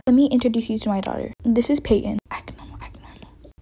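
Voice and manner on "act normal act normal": very soft whisper to herself; whispered aside. Acted. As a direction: mutter it so nobody hears.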